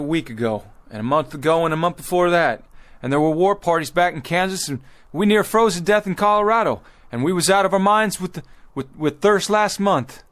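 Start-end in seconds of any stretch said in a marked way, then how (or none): 3.0-10.2 heated argument. Acted. Leader arguing, voice rising.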